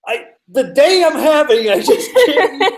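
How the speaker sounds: high, loud, breaking into laughter; overwhelmed laughter. Real, not acted. Traveler overwhelmed by the day she's having, laughing. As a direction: laugh helplessly through the words.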